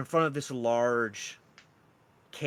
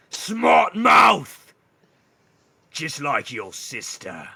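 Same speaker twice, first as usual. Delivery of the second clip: loud high bark sinking to a slow sneer; menacing minotaur; 5 semitones higher, 12 dB louder, much slower than usual. Acted. Minotaur to the smirking guard, menacing taunt. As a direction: throw the insult out loudly, then slow down.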